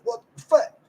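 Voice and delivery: squeaky high what-the; high mock disbelief. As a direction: squeal it, baffled. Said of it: "And I'm like".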